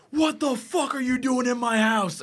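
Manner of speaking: high, drawn-out mock-outraged shout; retold angry yell. Retelling a friend catching intruders in his house, mock furious yell. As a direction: imitate an outraged homeowner, stretching the words. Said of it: "he just got really mad".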